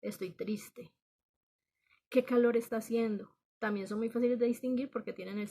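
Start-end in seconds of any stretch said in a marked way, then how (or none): 0.0-0.9 whispered "Estoy triste", mock sad. Shown as a demonstration. Teacher demos a sad exclamation, whispered. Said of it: "expresan una emoción". 2.1-3.3 exclamation. Shown as a demonstration. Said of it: "expresan una emoción".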